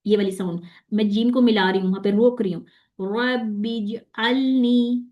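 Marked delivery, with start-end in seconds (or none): 3.0-5.1 रब्बिज अलनी slow, clean j; correct recitation. Shown as a demonstration. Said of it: "जीम में वो चा की sound नहीं आई".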